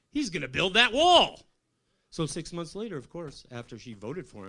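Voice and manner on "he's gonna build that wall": higher, louder mock prophecy; mock ominous warning. Telling his girlfriend Brittany what Trump will do, hammed-up warning. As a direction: warn her like a doom prophet.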